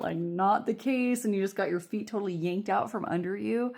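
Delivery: ordinary delivery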